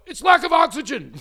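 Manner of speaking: high, loud pleading shout; desperate insisting. Retold or imitated. Begging her friend to believe the yawn, pleading shout. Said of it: "And I go".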